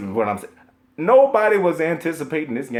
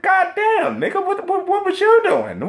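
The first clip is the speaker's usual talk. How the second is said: incredulous outburst; 4 dB louder than usual. Real, not acted. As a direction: shriek it in total disbelief. Mocking gamers who hate Destiny.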